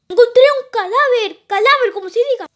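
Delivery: high, loud alarm at finding a body; alarmed shout. Pretend. Reporting a body found. (grito alarmado al encontrar un cadáver)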